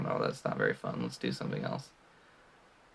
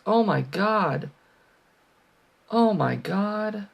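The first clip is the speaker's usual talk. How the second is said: higher, slow drawn-out groan; exasperated; 8 semitones higher, 3 dB louder, slower than usual. Real, not acted. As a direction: stretch it out in disbelief. Reacting to near miss.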